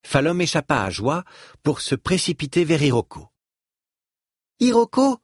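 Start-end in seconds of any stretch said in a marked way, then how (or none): 4.6-5.2 put-on eager child, slightly out of breath; high child's call, a touch breathless. A narrator's reading. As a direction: call out as a child who just ran up. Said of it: "lança-t-elle hors d'haleine".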